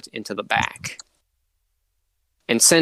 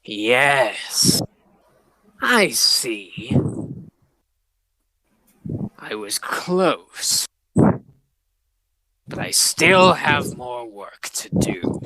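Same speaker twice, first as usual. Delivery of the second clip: villain whisper; much slower than usual. Acted. Villain muses after defeat.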